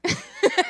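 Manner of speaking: shrieking giggle; high squeal of laughter. Real, not acted. Laughs at own rant.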